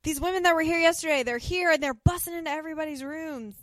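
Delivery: high, loud alarmed outburst; acted alarm. Dude warning Payne about women busting into rooms, alarmed. Retells man bursting in, alarmed.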